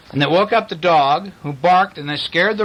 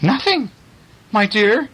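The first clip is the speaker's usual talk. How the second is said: high-voiced storybook farmer; meek farmer; 5 semitones higher than usual. A narrator's reading. Farmer answers his shrieking wife, high innocent voice. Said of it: "replied the farmer".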